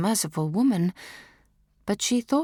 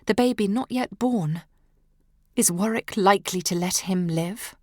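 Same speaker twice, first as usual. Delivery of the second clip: plain reading voice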